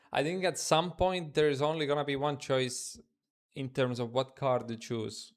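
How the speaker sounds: ordinary talk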